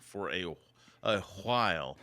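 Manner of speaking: "while" said as a breathy "hwile"; over-pronounce the wh in "while"; odd pronunciation. Real, not acted. Host reporting script was shopped around, oddly said "while" as "hwile". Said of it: "Why are you saying it like that?".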